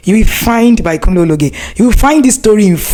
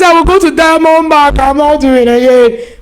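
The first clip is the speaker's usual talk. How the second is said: higher, louder upset protest; retold upset; 7 semitones higher, 3 dB louder than usual. Wife telling her uncle she quits, raised and upset. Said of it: "I said".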